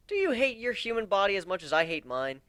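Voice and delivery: ordinary delivery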